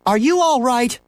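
high, worried calling question; shouting mother. A narrator's reading. Mother checking son is safe, mock shout. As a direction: call it out high, without full volume. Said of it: "Missus Huggins shouted".

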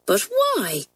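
put-on a high, surprised girl's question; puzzled and startled. A narrator's reading. Pat asks Kathleen why the farmer shoots dogs, surprised. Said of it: "asked Pat in surprise".

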